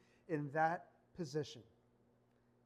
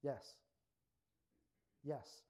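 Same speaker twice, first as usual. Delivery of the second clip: soft gentle; 4 semitones lower, 7 dB quieter than usual. Retold or imitated. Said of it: "To which Jesus says".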